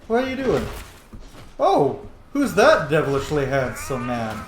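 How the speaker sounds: usual speaking voice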